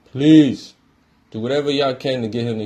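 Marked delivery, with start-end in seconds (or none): 0.1-0.7 a sudden loud begging please. Real, not acted. A fan loudly begging Houston to sign LeBron. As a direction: blurt out the plea.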